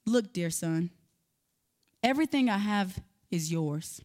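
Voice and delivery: soft, low, tender father's words; a father soothing his angry son, calm and gentle. Retold or imitated. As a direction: speak gently to a hurt son. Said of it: "in a calm, gentle manner".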